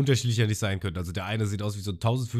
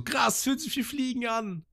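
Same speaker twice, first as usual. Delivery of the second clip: high, slow thrilled voice; Ruffy imitated. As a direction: marvel at flying like a kid. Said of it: "Und Ruffy so". (hohe, begeisterte Stimme)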